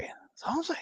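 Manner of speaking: high, breathy whisper repeating the line. Shown as a demonstration. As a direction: whisper it suggestively.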